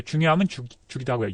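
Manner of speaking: usual speaking voice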